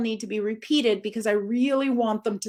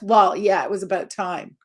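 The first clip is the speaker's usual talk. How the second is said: grudging; 2 semitones lower than usual. Shown as a demonstration. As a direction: sound unimpressed and put out. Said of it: "Not just".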